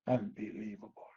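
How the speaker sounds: breathy disbelieving whisper; an unbelieving aside under the breath. Real, not acted. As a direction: hush it out in disbelief. Disbelief at sheikh's answer.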